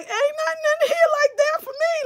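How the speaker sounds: high, put-on sassy voice; sassy aside. Scanning the men in the room, high sassy dismissal. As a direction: toss it off in a high, dismissive character voice. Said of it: "I was like".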